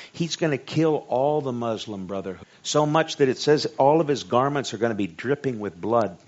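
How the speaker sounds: ordinary delivery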